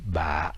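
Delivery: ba tout en bas; ba grave et enfoncé; very low tone. Shown as a demonstration. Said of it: "avec un accent très grave". (ba avec accent très grave)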